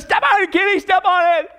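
high, urgent panicked plea; mock terror. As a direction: urge the driver on as if terrified. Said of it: "rolls up the window in terror".

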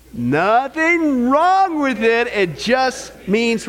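slow, stretched words; drawn-out catchphrase. Shown as a demonstration. Pastor cueing the congregation's refrain, slow and drawn out.

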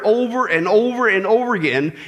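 ordinary talk

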